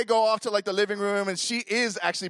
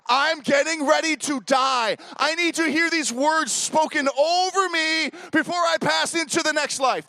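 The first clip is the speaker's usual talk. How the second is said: 1 dramatic inner panic; 10 semitones higher, 7 dB louder, slower than usual. Retold or imitated. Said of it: "this is what I'm thinking".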